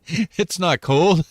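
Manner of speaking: slow, drawn-out scoffing Canadian; mocking Canadians. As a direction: stretch it out like a smug Canadian. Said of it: "people in Canada just make fun of me".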